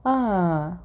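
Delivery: usual speaking voice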